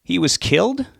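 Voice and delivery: can't believe what he hears; shocked question. Acted. Son stunned by news.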